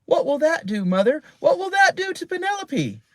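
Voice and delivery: put-on high, eager child questions; a girl bouncing with curiosity; excited girl. A narrator's reading. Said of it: "bounced up and down excitedly".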